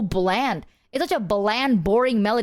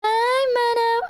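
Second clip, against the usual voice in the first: much higher, slow squeak; 13 semitones higher, 3 dB louder, much slower than usual. Pretend. Mock high voice to chat.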